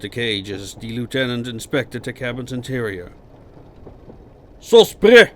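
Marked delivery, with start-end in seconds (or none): 4.6-5.3 very loud, high shout; barked command. A narrator's reading. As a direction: bark it across the whole cabin. Officer barks at the prisoners.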